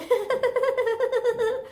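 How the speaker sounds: a high giggle, copying mom; imitating mom's laugh. Daughter imitates mom laughing about the lost rabbit, high giggle. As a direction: mimic mom's amused laugh. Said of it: "Like that".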